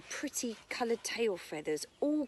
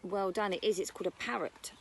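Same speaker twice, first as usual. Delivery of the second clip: normal talking voice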